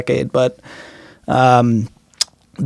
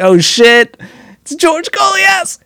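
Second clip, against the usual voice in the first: high-pitched, drawn-out fanboy squeal; giddy fanboy; slower than usual. Retold or imitated. Drummer reliving meeting his idol in the van, giddy squeal. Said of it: "You know, I got all excited".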